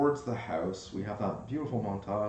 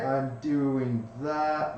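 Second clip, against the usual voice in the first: usual speaking voice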